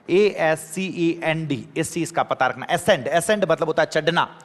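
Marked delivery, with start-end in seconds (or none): 0.1-1.7 letters spelled out slowly; slow spelling. Shown as a demonstration. Teacher spelling the verb slowly for the class. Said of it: "verb के रूप में होता है".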